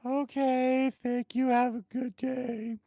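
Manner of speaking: high, drawn-out service voice; drive-thru worker. Retold or imitated. Drive-thru worker seeing customer off, high mock voice. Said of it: "you can hear the".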